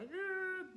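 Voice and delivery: high strained groan through gritted teeth; mother in labour groaning through her teeth. Retold or imitated. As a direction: squeeze it out through clenched teeth. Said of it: "gritting my teeth".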